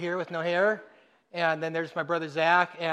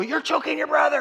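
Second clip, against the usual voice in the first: mom shouting; 9 semitones higher than usual. Retold or imitated. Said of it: "My mom would go".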